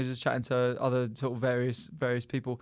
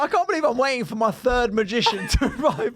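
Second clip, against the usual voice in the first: laughing incredulous; 6 semitones higher than usual. Retold or imitated. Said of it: "I was like".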